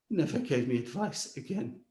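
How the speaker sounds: ordinary talk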